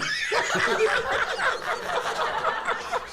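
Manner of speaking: loud, high burst of laughter; big laugh. Real, not acted. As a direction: crack up at the story, long and loud. Cracks up at his story.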